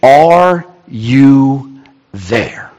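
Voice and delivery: extremely slow, words set apart; drawn-out cry to God. Acted. All of us crying out to God, drawn-out plea. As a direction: leave space after every word. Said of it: "when we cry out to God and say".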